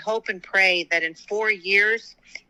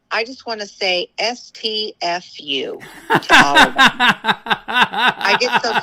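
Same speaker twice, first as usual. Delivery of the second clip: exasperated laugh; 2 semitones higher, 6 dB louder, slower than usual. Real, not acted. Fed up with media name-calling.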